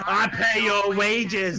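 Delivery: loud, slow customer jibe; mock entitled customer. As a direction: draw it out as a pompous complaint.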